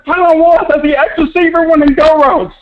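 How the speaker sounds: real exasperated high-pitched yelling, laughing in disbelief; crashing out. Real, not acted. Caller ranting to host about the coaching, exasperated yelling. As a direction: rant at the top of your voice, half laughing, half crying. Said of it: "never seen Cam crash out like that".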